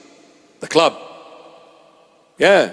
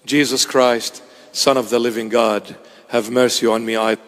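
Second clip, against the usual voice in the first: prayer; 6 semitones lower than usual. Shown as a demonstration. Preacher demonstrates the Orthodox Jesus prayer, lowered murmur. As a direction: pray it like the beads, low and steady. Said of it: "if you are an Eastern Orthodox".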